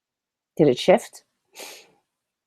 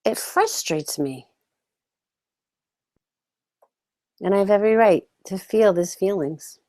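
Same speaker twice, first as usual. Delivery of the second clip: guided tapping script. Acted. Coach leading viewers through a tapping round, calm and measured. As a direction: say each line so listeners can echo it back.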